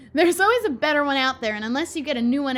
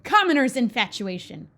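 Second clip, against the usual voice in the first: ordinary delivery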